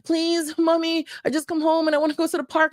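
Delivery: put-on high, fast kid voice nagging mom; child begging, high and rushed; eager child asking. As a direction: pester mommy like an excited little kid. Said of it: "this is something I would always do".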